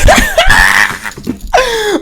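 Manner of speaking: shrieking laugh. Real, not acted. Celebrating the final.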